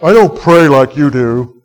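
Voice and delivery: lightly put-on voice of a reluctant parishioner; imitated churchgoer. Churchgoer telling the pastor about praying, lightly imitated. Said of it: "I've had people tell me this".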